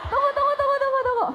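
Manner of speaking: real laughter breaking in; giggling wait wait wait; laughing. Real, not acted. Laughing while stopping herself.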